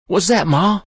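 high, loud eager question. A narrator's reading. Boy hearing Ma give in, eager. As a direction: jump in with excitement.

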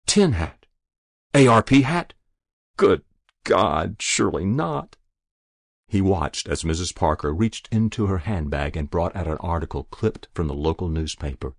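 plain reading voice

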